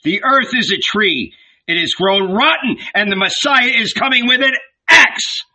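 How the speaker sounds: fired-up preaching, rising in pitch; impassioned prophet's warning; shouted prophecy. A narrator's reading. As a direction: cry doom, building to a high peak. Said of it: "and shouted".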